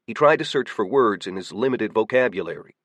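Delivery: ordinary delivery, nothing marked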